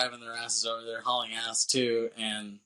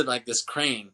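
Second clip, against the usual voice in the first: ordinary talk, nothing marked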